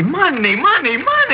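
gleeful gloating. Acted. Thug gloats over the cash.

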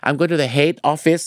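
"head office" said as hate office, slow; Thai learner's mispronunciation. Acted. Teacher mimics learner heading to the main office, "head" with long ay. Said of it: "บางชาวไทยบางคนออกเสียง".

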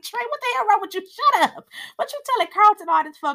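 high, loud, fast scolding voice; hammed-up high-pitched nagging; mock friend scolding. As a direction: squeal at him like an annoyed friend. Mocking Trey's friend yelling.

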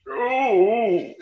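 high put-on groan of stomach pain; hammed-up pained ooh; mock pained ooh. Voicing the man's stomach pain.

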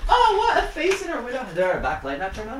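high startled hushed voice; alarmed surprise. Real, not acted. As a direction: react in sudden fright. Startled by face in window.

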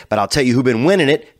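ordinary talk, nothing marked

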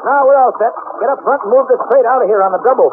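urgent shout above the plane noise; shouting orders over engine. Acted. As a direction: bark it over the roar.

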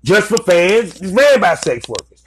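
ordinary talk, nothing marked

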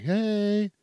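high cooing hey; lightly sweet baby talk; pet voice. Retold or imitated. Greeting a pet in a cooing pet voice. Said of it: "in that pet voice".

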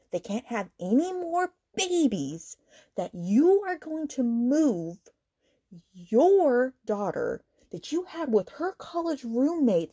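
slow, emphatic outrage with swooping pitch; incredulous scolding that climbs high on key words; indignant rant. Real, not acted. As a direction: drag out each damning detail, appalled. Ranting at the character's cruelty.

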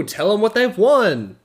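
loud, high game show announcer; seventies game show host. Acted. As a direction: hype up the prize like a TV host. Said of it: "seventies game show host".

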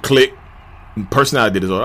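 usual speaking voice

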